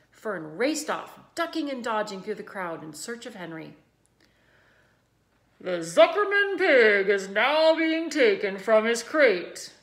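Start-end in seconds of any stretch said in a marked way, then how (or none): 5.6-9.7 loud, slow PA boom; booming loudspeaker. A narrator's reading. Announcer booming to the crowd, loud and slow. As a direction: boom it to the grandstand. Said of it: "boomed the voice of the loudspeaker".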